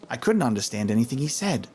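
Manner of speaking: ordinary delivery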